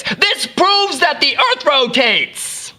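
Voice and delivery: high, slow mock-grand announcement; triumphant proclamation. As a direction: proclaim the discovery to a crowd, drawn out. Acting Foucault's proclamation to crowds.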